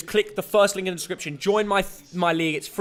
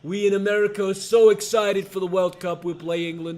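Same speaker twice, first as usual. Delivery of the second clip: imitation American politician voice; Donald Trump. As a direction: do an impression of a boastful American president. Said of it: "my Donald Trump?".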